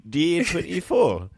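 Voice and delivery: lightly done Geordie reality-TV commentary; Geordie accent. Pretend. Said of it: "that was my best Geordie accent".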